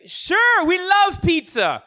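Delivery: high, slow, overjoyed acceptance; hammed-up delight at free food; mock enthusiasm; eager yes. Broke couple eager for pizza.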